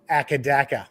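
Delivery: "Akadaka" with short flat a's, as in "back"; correct pronunciation. Shown as a demonstration. Australian correcting co-host's slang, "Akadaka" said with short a's. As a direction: say "Akadaka" evenly, short a in each stressed syllable. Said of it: "no, you got the last bit wrong".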